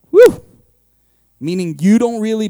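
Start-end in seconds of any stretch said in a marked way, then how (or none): 0.1-0.4 loud high whoop of delight; preacher's loud celebratory woo; excited whoop. Real, not acted. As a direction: let out a joyful woo. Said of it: "preacher's cry".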